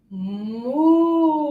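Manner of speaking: long, drawn-out moo; slow imitation of a lowing cow. Shown as a demonstration. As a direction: moo like a cow, holding the vowel. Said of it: "So first the cow".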